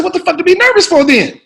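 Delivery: loud, high, exasperated star actor; Sam Jackson impression. Veteran actor challenging the newcomer's nerves, exasperated impression. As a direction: berate the rookie in disbelief. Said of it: "He said".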